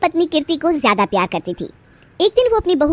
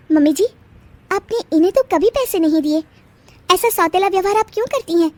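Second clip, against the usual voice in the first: put-on higher young voice, pointed question; a young bride's higher voice, accusing her mother-in-law; challenging young wife; 7 semitones higher than usual. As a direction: confront your mother-in-law.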